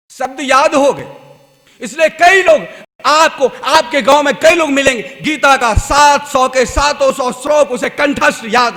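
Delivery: loud, driving sermon voice; fervent preaching. Acted. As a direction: preach it out to the crowd. Preacher exhorts loudly.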